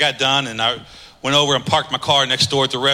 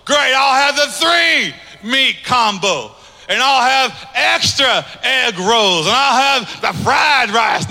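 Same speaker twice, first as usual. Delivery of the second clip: higher, slow, savoring each item; eager ordering; 9 semitones higher, 4 dB louder, slower than usual. Retold or imitated. Hungry man gleefully ordering at the counter, eager. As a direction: relish every item as you order it.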